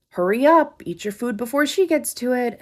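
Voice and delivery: high, loud, jeering imitation of the waiter; mocking rude waiter. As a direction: sneer it out like the obnoxious waiter.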